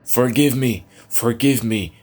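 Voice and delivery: high, loud taunting shout; mocking echo. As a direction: throw his words back at him. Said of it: "the voices echoed".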